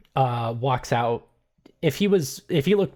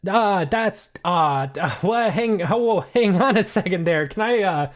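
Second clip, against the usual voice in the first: flustered panic; 7 semitones higher, 5 dB louder than usual. Acted. Anderson caught out, stammering.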